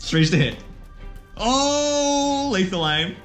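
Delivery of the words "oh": long, high-pitched "ohhh"; excited "oh". Real, not acted. Player reacts to a lucky dice roll, excited drawn-out "ohhh".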